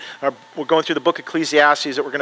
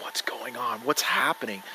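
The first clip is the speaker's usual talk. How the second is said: very soft, low frightened whisper; whispered panic; 7 semitones lower, 14 dB quieter than usual. Retold or imitated. Trapped woman in the coffin, acted panicky whisper. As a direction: whisper as if starting to freak out. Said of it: "she's freaking out".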